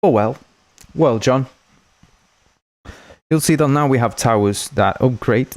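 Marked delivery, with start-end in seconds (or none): none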